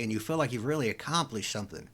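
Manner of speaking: ordinary talk, nothing marked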